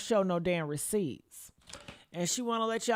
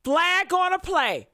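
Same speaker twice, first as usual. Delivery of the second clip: loud, slow referee call; 7 semitones higher, 5 dB louder than usual. Acted. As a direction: throw the flag like a referee. Host calls out the lie.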